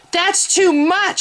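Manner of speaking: high, breathless cry, slow; panting. A narrator's reading. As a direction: gasp it out in alarm. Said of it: "she panted".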